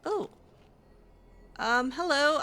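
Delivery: ordinary talk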